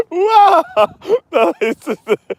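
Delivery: high laugh breaking out; laughing wow. Real, not acted. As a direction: burst into laughter. Bursting out laughing.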